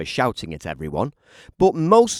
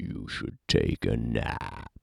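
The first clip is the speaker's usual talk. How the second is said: much lower, laughing; sleepy animal; 12 semitones lower, 5 dB quieter than usual. Acted. A big animal advising Jim to nap, much lower. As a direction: rumble it lazily.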